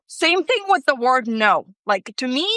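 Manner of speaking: normal talking voice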